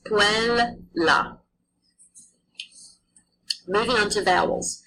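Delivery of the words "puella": "puella" slow, both L's sounded separately; Latin pronunciation. Shown as a demonstration. Teacher modelling double consonants for the class, slowly. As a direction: stretch "puella" with a clear double L. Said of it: "So you pronounce".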